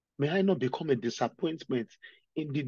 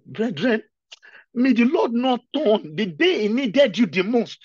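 higher, louder passionate exhortation; fervent preaching; 7 semitones higher, 10 dB louder than usual. Real, not acted. Preacher exhorting brethren, louder passionate plea. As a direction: plead with the congregation.

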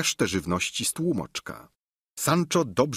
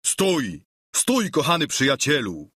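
mildly raised cry to stop; calling out; 5 semitones higher, 3 dB louder than usual. A narrator's reading. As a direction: call a halt to a friend, pitch lifted. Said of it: "zawołał". (lekko podniesiony okrzyk)

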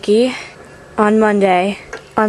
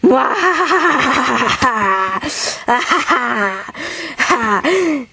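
evil cackle; 3 semitones higher, slower than usual. Acted. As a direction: cackle like a mad scientist. Evil laugh again.

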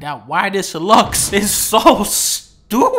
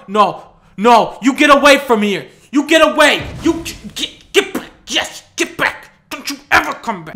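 shooing; 5 semitones higher, 5 dB louder than usual. Pretend. Shooing enemy away from the trap.

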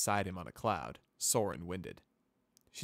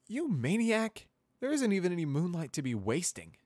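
put-on exasperated young mare; 11 semitones higher, 6 dB louder than usual. Groaning at pre-dawn outing.

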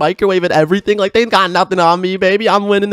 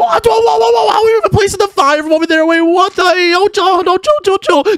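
panicked alarm; 11 semitones higher than usual. Real, not acted. Player panicking at microwave girl as fire hits the wall.

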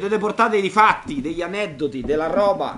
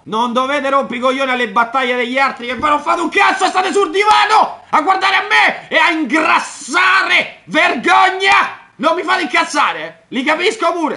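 furious shout, much higher and louder; raised voice; 9 semitones higher, 7 dB louder than usual. Real, not acted. Streamer yelling at his chat in a rage. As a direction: rant at the lazy viewers on the sofa. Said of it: "ho alzato la voce".

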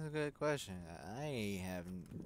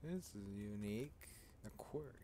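ordinary talk, nothing marked

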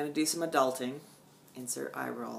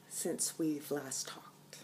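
whisper; 7 dB quieter, much slower than usual. Real, not acted. Dramatic whisper aside.

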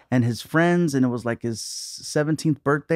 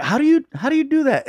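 baffled disbelief; 12 semitones higher, 3 dB louder than usual. Retold or imitated. Comic questioning his agent about a strange gig, baffled. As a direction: voice leaping high in bafflement. Acts out baffled reply to offer.

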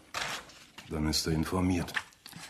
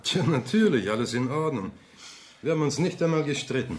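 gespielt munter; 8 semitones higher than usual. Acted. Ehemann überspielt Spannung.

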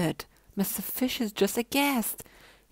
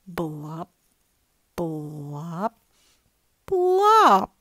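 blop sounds building; 4 semitones lower, 8 dB louder, much slower than usual. A narrator's reading. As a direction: let the last blop burst out. Blop refrain, last one loud.

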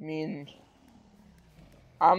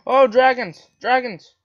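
excited exclaiming; 4 semitones higher, 6 dB louder than usual. Real, not acted. Thrilled at dragons in game.

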